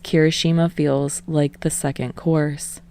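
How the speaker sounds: usual speaking voice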